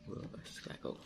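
soft whispered aside; whispering. Real, not acted. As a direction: whisper it to grandpa. Aside to grandpa while filming.

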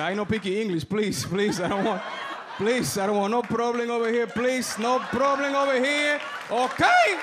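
put-on pleading in a thick Spanish accent, rising to a shout; hammed-up Spanish-accented begging; dad's heavy Spanish accent. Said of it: "Turned into my dad quick".

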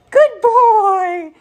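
high, loud praise for a dog; gushing high-pitched dog praise; excited praise. Real, not acted. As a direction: burst out with delighted, drawn-out praise. Praising dog for ringing bell.

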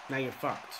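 ordinary talk, nothing marked

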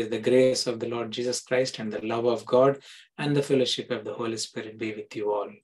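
ordinary talk, nothing marked

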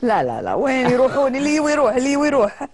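carefree, mocking shrug; playful dismissal. Real, not acted. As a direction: toss it off with a laugh in the voice. Joking dismissal of lost followers.

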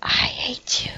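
hushed weepy voice; hammed-up heartbroken whimper; mock tearful whisper. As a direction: whisper it as if crying. Betrayed character whimpering.

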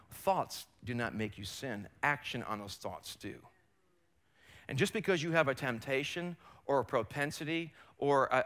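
normal talking voice

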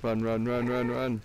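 loud urgent run chant; yelling while running away. Real, not acted. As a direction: shout it while fleeing. Fleeing mobs, shouting.

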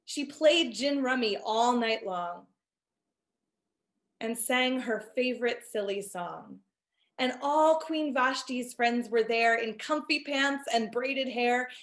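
ordinary delivery, nothing marked

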